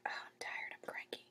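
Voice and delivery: unvoiced sleepy whining; tired student complaining under breath; mock cranky mumble; groggy student whisper. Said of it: "You're like".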